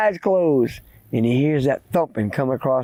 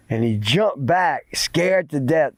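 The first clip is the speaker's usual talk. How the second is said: usual speaking voice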